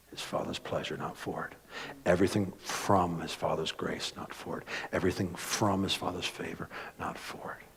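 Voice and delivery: preacher hushing to a whisper on not for it; hushed. Real, not acted. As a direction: drop to an intimate whisper.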